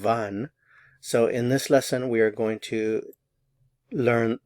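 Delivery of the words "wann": wann, short vann with a V and rising end; German wann. Shown as a demonstration. Teacher repeats wann for learners with a V sound. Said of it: "Notice the pronunciation".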